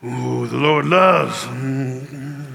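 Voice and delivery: slow, low mock-preacher croon; Southern preacher impression. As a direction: draw it out low, fishing for a response. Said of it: "so he'd be like this".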